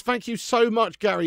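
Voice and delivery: ordinary delivery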